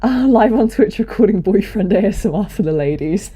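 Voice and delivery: laughing tease. Real, not acted. Laughing tease about boyfriend ASMR.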